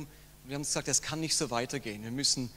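ordinary talk, nothing marked

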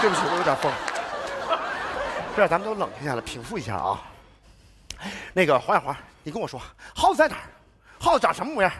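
low, deliberately calm voice. Acted. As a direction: bring it right down, soothing.

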